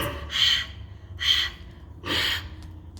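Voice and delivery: breathy gasping wheeze; wheezing laugh. Acted. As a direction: wheeze before the punchline.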